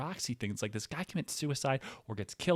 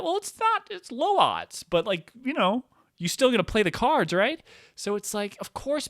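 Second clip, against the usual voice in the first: much higher, louder insistent rant; excited high rant; 9 semitones higher, 5 dB louder than usual. Real, not acted. Comic arguing about playing the cards, high and loud. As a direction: push it up high and hard.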